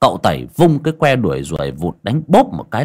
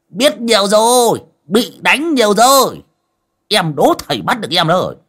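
naughty pupil mocking the teacher, much higher; cheeky taunt; 12 semitones higher, 3 dB louder than usual. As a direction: taunt him from a safe distance. Taunting after dodging a swat.

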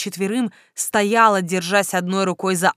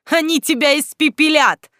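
higher, louder threat; shouting; 7 semitones higher, 5 dB louder than usual. A narrator's reading. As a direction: fling the threat out boldly. Heroine threatening the necromancer.

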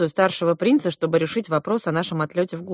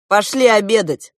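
loud, higher hearty call; raised-voice host's invitation; calling to dinner; 4 semitones higher, 7 dB louder than usual. A narrator's reading. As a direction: call the guests to the table. Prince calls guests to table.

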